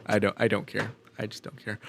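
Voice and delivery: mock bored mumble; deadpan indifference. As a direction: check out completely. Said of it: "I go".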